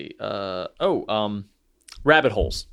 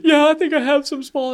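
put-on higher, small-boy voice; imitating kid; 4 dB louder than usual. Dad mimics his son's reply, kid voice. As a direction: play the son, perking up.

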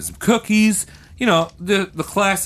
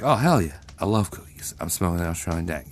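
low, softer stoner voice, speeding up at the end; stoned giant bird; 10 semitones lower, 7 dB quieter, faster than usual. Acted. The giant kiwi bird craving cookies, low dopey stoner voice. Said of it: "the kiwi's like".

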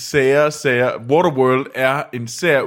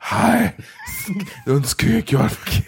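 mock sensual; 12 semitones higher than usual. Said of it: "skal jeg prøve at være sensuel for dig?".

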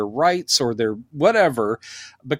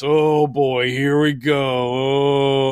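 sarcastic eye-roll; much slower than usual. Retold or imitated. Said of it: "they're like going".

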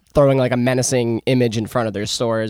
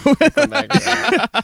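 mock echo; 12 semitones higher, much slower than usual. Mocking echo.

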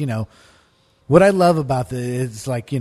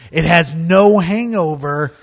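loud, slow and emphatic; pushy reassurance; 4 semitones higher, 8 dB louder, slower than usual. Retold or imitated. Stoner reassuring his friend, loud and slow. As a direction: sell it hard. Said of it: "He goes".

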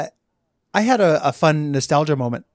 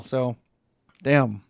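ordinary talk, nothing marked

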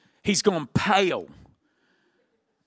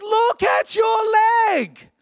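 high, hammed-up horrified holler; high, stretched-out warning shout; mock alarmed yell; 3 dB louder, slower than usual. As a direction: imitate a panicked mate calling downhill. Friend yells warning again.

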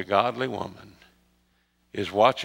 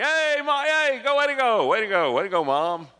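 high, excited stadium cheer; cheering fan; 11 semitones higher than usual. Retold or imitated. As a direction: whoop it up like a fan in the bleachers. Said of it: "you jump up and you cheer".

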